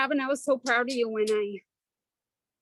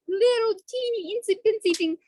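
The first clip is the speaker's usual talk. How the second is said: cutesy tiny voice; 7 semitones higher than usual. Acted. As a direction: jump much higher on "teeny" and "insy-pincy". Mocking how tiny the mistake is.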